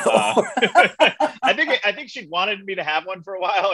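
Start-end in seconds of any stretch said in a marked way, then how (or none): 0.6-3.7 laughing. Real, not acted. Laugh heard on lines.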